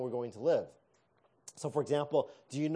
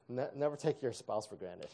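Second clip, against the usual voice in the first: usual speaking voice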